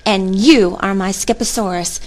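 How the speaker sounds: ordinary delivery, nothing marked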